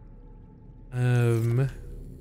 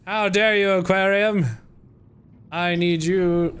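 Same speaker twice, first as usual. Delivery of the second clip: theatrical "how dare you" at a game object; mock outrage; 6 semitones higher than usual. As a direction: pretend to be deeply offended by the tank. Mock outrage at full aquarium.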